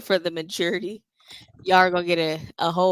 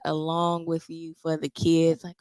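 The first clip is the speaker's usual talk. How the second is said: normal talking voice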